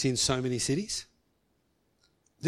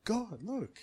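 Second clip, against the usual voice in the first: soft pleading; 5 semitones higher, 5 dB quieter than usual. Retold or imitated. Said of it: "I said".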